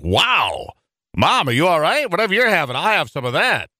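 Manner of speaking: high-pitched, wide-eyed retold astonishment; imitated boyish wonder, pitched well up; mock amazement. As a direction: play a kid amazed at an excited mom. Retells boyhood amazement at excited mother.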